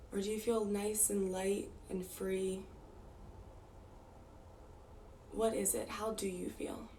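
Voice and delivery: unhurried meditation guide, long pauses between phrases; guided meditation. Real, not acted. Teacher guiding the group to close eyes and notice breath, calm guided meditation. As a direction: ease them inward, leaving space after each instruction.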